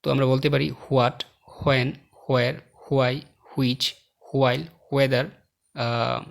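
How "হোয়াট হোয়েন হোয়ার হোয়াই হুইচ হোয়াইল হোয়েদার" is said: "হোয়াট, হোয়েন, হোয়ার" and the rest begun with a breath of H before W; sounded H. Shown as a demonstration. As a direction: sound HW at the start of each word, "হোয়াট" through "ওয়েদার". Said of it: "এখানে এইচ উচ্চারিত হয়".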